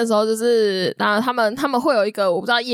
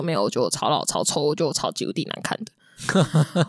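forced polite laughter; 8 semitones lower, 4 dB quieter than usual. Retold or imitated. Telling the salesperson she looks ugly, with a forced smile. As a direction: lower and softer, forced smile. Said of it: "我一直是笑着跟他说".

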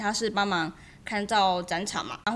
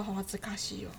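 embarrassed murmur; 10 dB quieter, much faster than usual. Real, not acted.

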